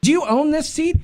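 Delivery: high, indignant mock scolding; a retold lady putting a rude man in his place; mock telling-off. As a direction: imitate her tearing into him. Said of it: "she went off".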